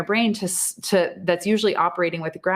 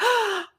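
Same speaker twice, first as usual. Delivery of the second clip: high sharp intake of breath; a moved gasp, much higher. Retold or imitated. Moved while watching the show.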